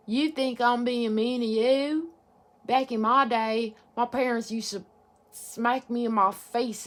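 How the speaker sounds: put-on drawled back-in-my-day elder's accent; indignant parent lecturing in a folksy accent; put-on old-timer accent. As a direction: scold in a put-on accent, stretching the words. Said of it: "why that accent came out".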